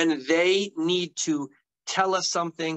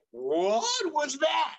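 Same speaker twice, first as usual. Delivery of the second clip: much higher, slow drawn-out baffled question; puzzled friends; 10 semitones higher, much slower than usual. Retold or imitated. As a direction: act completely baffled by what you saw. Adults puzzled by the wrist gesture.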